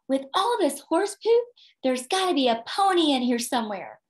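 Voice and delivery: put-on high, loud, thrilled child voice; eager boy digging in horse poop, delighted; excited little boy. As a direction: gush it like a kid sure of a pony. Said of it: "he's all excited".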